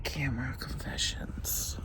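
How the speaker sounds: hushed confession whisper. Real, not acted. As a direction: whisper it so no one wakes. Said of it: "That's my confession".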